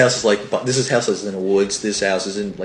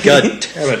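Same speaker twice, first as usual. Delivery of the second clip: higher, louder curse through a laugh; 5 semitones higher, 5 dB louder, slower than usual. Real, not acted. Cohost cracking up at the neighbor gag, laughing. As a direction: laugh it out in disbelief.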